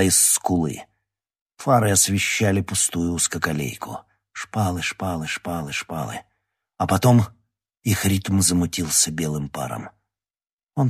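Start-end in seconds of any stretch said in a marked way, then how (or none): 4.3-6.2 fading repetition. A narrator's reading. Rhythmic repetition fading into distance.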